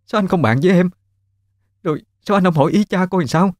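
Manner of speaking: high-pitched, anxious wife pleading; frantic worried questioning, pitched high; panicked wife. Acted.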